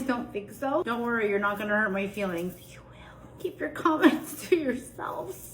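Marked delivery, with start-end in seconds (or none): 2.7-5.5 playful whisper breaking into a laugh; mock whispered warning. Cook warns viewers off mean hair comments, mock whisper. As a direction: whisper a teasing threat, drawn out.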